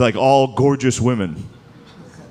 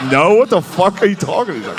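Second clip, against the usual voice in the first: incredulous; 5 semitones higher than usual. Retold or imitated. Comic answers Trump's nuke idea, mock incredulous. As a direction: react in shocked disbelief.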